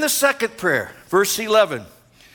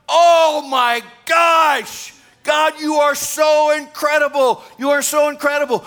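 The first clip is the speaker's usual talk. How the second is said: awestruck worship cry; 5 semitones higher, 4 dB louder than usual. Pretend. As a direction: cry out in overwhelmed wonder to God. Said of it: "we're gonna be going".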